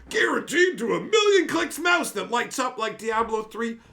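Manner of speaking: hyped loud brag about the mouse; excited shout. Real, not acted. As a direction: shout about the new gear. Hyped listing of new gear.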